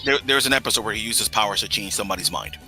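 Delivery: ordinary talk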